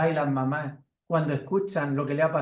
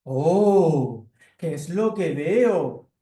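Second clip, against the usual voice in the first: loud, slow, different-voiced cat; cat at the window, loud and slow; 2 semitones higher, 6 dB louder, much slower than usual. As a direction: stretch out the surprise like a big cat. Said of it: "el gato aparece por la ventana y dice".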